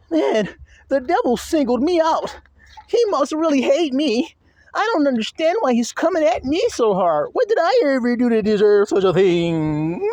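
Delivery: high, whining self-pity; mock the self-pitying believer; whiny complainer. Preacher mocking a believer whining about the devil. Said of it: "So when you're going".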